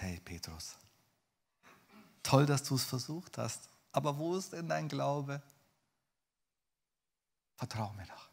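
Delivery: tender, reassuring appeal; loving Jesus. Acted. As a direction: coax kindly, ending hushed. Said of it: "hörst du vielleicht die Liebe Gottes".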